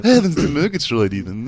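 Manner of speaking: high, loud cartoon voice; hammed-up cartoon exclamation; Snagglepuss. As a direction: belt it out like a theatrical cartoon cat. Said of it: "do my Snagglepuss impression again".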